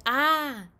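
loud, drawn-out mock scream; demonstrated cry. Jamie demonstrates his cry for Mom, mock scream. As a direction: act out the startled cry. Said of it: "It was like, 'Ah!'".

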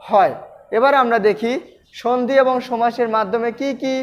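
ordinary delivery

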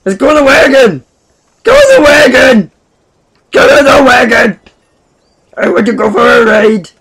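high, excited shouting; playful yelling. Pretend. Playful calling out while playing.

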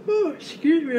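yawning through the words; yawning sigh. Real, not acted. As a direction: talk mid-yawn, stretched and high. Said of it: "I'm yawning out of nowhere".

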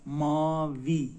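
ماوی slowly, ending in a long clear EE; clear final i. Shown as a demonstration. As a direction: stretch ماوی into MAA-VII, loud, no dull ı at the end. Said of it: "او نیست". («ماوی» کشیده با «ای» روشن در آخر)

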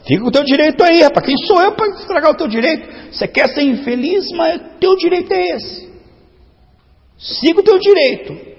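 mocking go-ahead; sarcastic concession. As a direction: pretend to give way with heavy sarcasm. Sarcastic reply to sulky listener.

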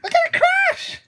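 high, shouted cries for help; panicked shouting. Acted. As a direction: yell like you're spinning out of control. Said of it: "they shouted as the carriage is spinning".

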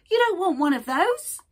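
high, loud, pushy shopkeeper rat; cheeky rat voice. Acted. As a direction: sell it like a brash little rat. Rat speaks in story.

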